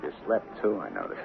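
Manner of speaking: soft, low, whispered reproach; half whisper it at her; husband quietly reproaching his wife; hushed. Acted. Quiet dawn accusation.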